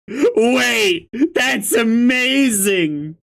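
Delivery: high, stretched-out delight; amazed. Real, not acted. Reader thrilled by the badass line, high amazed voice.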